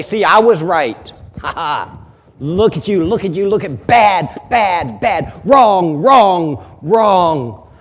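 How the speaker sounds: loud, gloating imitation of an accuser; mock gleeful. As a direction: crow over someone's failure, hammering each repeated word. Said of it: "he's almost gleeful".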